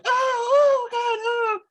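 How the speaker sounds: high, loud panicked wailing; panicked cry. Acted. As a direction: cry out as if rats are swarming all over you. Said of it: "she's like".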